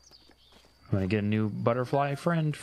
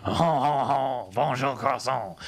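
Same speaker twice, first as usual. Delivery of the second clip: mock French echo; 2 semitones higher than usual. Echoing a mock French greeting.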